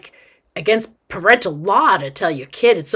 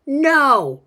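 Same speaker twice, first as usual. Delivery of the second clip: higher, emphatic no; indignant outburst; 6 semitones higher, 3 dB louder than usual. A narrator's reading. Boy rejects idea that she was invited, indignant outburst. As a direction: burst out with the obvious answer. Indignant answer to own question.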